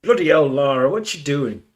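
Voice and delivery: a hammed-up, clumsy Yorkshire accent; exasperated Yorkshireman in a bad Yorkshire accent. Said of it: "I know that's a bad Yorkshire accent".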